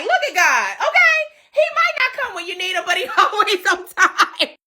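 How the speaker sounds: high, churchy praise with a laugh; mock preacher praise. [name] stepping out on the Empire State Building, churchy praise. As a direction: testify like a church auntie.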